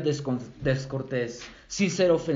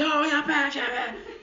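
mock yell; 11 semitones higher, slower than usual. Preacher mimics yelling at someone who cut in, high mock yell. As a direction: splutter in fake outrage. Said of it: "¿Gritas así?".

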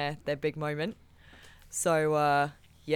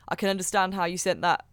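usual speaking voice

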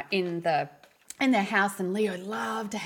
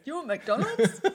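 high, laughing imitation of dad; dad's cheerful offer, laughed out; laughing dad quote; 6 semitones higher, slower than usual. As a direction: mimic dad offering McDonald's through a laugh. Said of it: "my dad goes".

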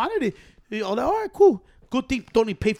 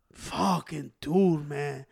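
slow scold; 6 semitones lower, 6 dB quieter, much slower than usual. Retold or imitated. Nephew scolding slowly.

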